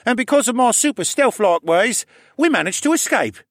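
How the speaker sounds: put-on high, loud bragging kid; boastful boy voice. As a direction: puff yourself up like a cocky boy.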